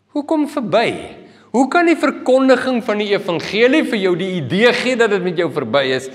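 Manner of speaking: ordinary delivery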